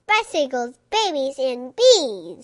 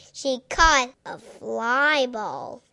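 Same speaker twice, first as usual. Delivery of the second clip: ordinary delivery, nothing marked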